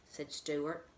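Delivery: usual speaking voice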